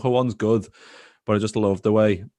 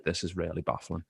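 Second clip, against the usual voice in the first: much softer, under-the-breath bafflement; baffled mutter; 3 semitones lower, 9 dB quieter than usual. Retold or imitated. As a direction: say it to yourself, lost for words. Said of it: "and I was just like".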